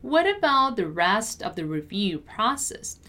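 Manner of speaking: measured, carefully articulated teaching read; word-by-word pronunciation model. Shown as a demonstration. Teacher models sentence slowly first.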